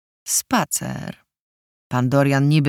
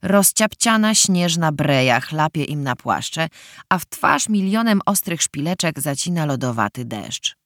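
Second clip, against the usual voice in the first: ordinary delivery, nothing marked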